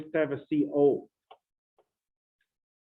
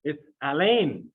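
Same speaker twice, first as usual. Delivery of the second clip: French accent; slower than usual. Shown as a demonstration. Chemistry teacher names aluminum hydride Alain, French accent. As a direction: say Alain the French way, ah-LAN with a nasal end. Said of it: "with a French accent".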